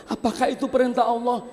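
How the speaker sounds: high, fast question; quick high question; questioning wife. Acted. Wife asks husband if it is God's command, high.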